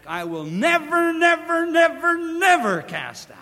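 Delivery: preacher driving home the Greek emphasis; emphatic repetition. Shown as a demonstration. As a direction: hammer never never never never, building. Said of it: "in the Greek it's emphatic".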